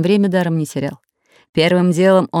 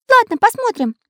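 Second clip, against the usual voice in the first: Karandash's voice. A narrator's reading. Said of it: "согласился Карандаш".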